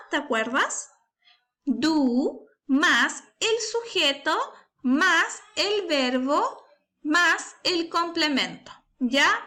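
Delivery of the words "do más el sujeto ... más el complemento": slow and deliberate, each part set apart; slow spelled-out formula. Shown as a demonstration. Teacher reminding a pupil of question order, slow and deliberate. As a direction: lay out the word order step by step. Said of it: "¿Y cuál es el orden de la pregunta?".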